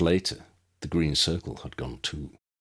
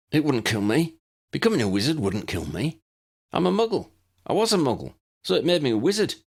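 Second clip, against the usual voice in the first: insistent protest, pitched up high; high, loud protest; 10 semitones higher, 4 dB louder, faster than usual. Acted. Granville protesting to Lucius, high and loud. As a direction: push back, desperate to be right. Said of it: "Granville protested".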